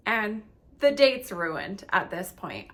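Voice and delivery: ordinary talk, nothing marked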